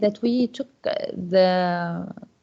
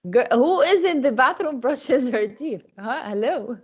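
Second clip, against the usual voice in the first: higher-pitched, insistent questioning; calling out; 6 semitones higher than usual. Real, not acted. Teacher to girls about bathroom noise, calling out. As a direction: demand to know who it is.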